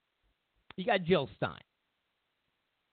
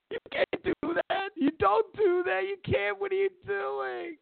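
much higher, whiny crying mock voice; mocking lefties. Ranting vlogger mocks lefties upset with Trump, high crying whine. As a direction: squeal it like a hysterical protester. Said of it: "all the lefties are like".

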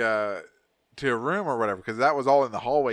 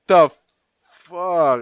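loud, raised burst of mock disbelief; shocked outburst; 4 semitones higher, 10 dB louder than usual. Patient hearing the real price, shocked outburst. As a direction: blurt it out in shock.

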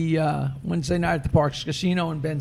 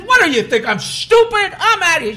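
louder and higher, fed up; indignant outburst; 10 semitones higher, 7 dB louder than usual. Acted. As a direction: storm off insulted, voice up. Buyer storms off angrily.

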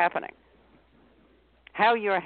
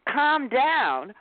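mock patronising; 6 semitones higher, 3 dB louder than usual. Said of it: "someone coming in and saying".

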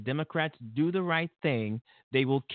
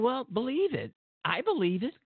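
higher, mocking put-on voice insisting; mock Trump voice; 11 semitones higher than usual. Vlogger mocking Trump insisting on a lie, high put-on voice. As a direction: ham up a stubborn liar. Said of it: "he's saying".

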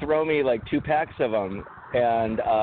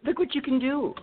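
much higher, hammed-up infomercial voice; mock sales pitch; 7 semitones higher than usual. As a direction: sell it like a cheesy TV ad. Mocking Chia Pet sales pitch.

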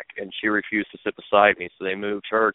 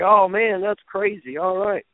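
higher-pitched retold astonishment; imitated amazement; 10 semitones higher than usual. Jeff reacting to the TV plug by phone, imitated amazement. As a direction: mimic a friend marvelling at the news.

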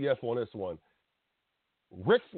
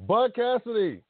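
booming announcer call, higher and louder; 6 semitones higher, 3 dB louder than usual. Pretend. Host summoning his co-host to the mic, announcer style. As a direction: call him in like a ring announcer.

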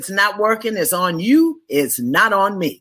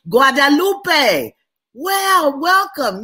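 delighted greeting; 9 semitones higher, 4 dB louder, much slower than usual. Real, not acted. Excited greeting of a far place.

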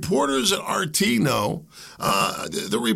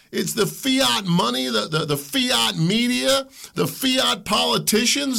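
slow, high, hitting "fiat" each time; emphatic stress; 7 semitones higher than usual. Real, not acted. Host denouncing fake money and media, stressing fiat. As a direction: hammer the word "fiat".

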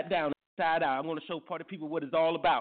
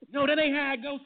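normal talking voice

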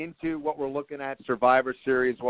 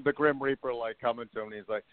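normal talking voice

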